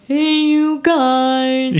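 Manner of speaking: high, long drawn-out holler; Goonies movie call. Retold or imitated. Co-host quotes The Goonies, high drawn-out call. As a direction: stretch each word into a goofy rallying cry. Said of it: "The Goonies".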